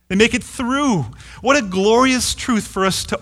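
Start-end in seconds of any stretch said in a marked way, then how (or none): 0.1-1.0 "through" pitched high and held, then falling; stress on THROUGH. Shown as a demonstration. Preacher assuring believers they survive the valley, stress on "through". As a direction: hit "through" high and emphatically. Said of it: "Emphasis on the word through".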